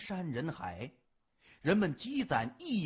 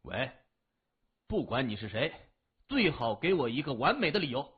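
a touch louder, fast and gruff into the phone; loud answer; 6 semitones lower, faster than usual. Acted. Annoyed boy answering the phone, a mild loud snap. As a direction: bark the question a bit at the caller, lightly played. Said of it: "他大声地说".

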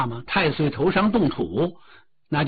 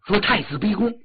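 ordinary delivery, nothing marked

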